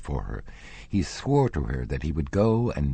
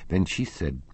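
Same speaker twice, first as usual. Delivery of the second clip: plain reading voice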